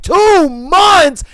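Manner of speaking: high, loud, incredulous yell; shocked shout, acted out. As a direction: blurt it back in outraged disbelief, imitating him. Boyfriend's retold shocked outburst.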